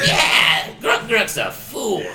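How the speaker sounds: high, shouted goblin agreement; second goblin. Acted. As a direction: gleefully pile on as a loud goblin, drawn out. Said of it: "And the other guy's like".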